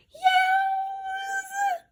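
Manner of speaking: piercing squeal of pain, way up high; yelping mouse screech. A narrator's reading. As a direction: shriek as a suitcase crushes your paw. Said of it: "I screeched".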